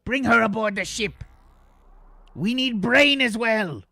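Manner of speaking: high, far-off character voice. Acted. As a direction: speak up as the commanding figure. Player voicing a character's command.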